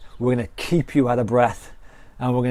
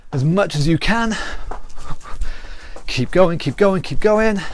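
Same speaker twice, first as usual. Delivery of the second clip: higher, pumped-up calls over the exercise; workout coaching calls; 4 semitones higher than usual. Acted. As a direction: cheer them through the round. Calling out during a workout round.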